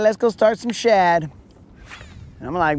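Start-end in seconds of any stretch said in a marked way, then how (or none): 0.0-1.3 higher, lightly mocking buddy voice; imitating Moon again. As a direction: tease him with a raised voice. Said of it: "So he's all like".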